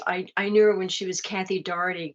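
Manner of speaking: normal talking voice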